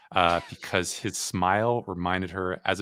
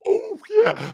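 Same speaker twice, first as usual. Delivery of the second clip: Kool-Aid Man; 8 dB louder than usual. Shown as a demonstration. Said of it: "pretty good approximation of the Kool-Aid Man saying".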